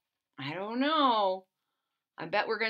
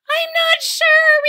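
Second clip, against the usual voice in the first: very high, loud, slow scared voice; nervous leaf watching the dark; frightened; 11 dB louder, slower than usual. Acted. Leaves frightened by rumble.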